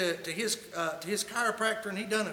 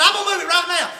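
higher and louder, fed-up yell; exasperated shout; 8 semitones higher, 11 dB louder, faster than usual. Retold or imitated. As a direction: bark it out, done with the nagging.

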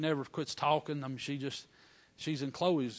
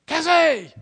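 calling out; 12 semitones higher, 13 dB louder than usual. Retold or imitated. Calling for reinforcements.

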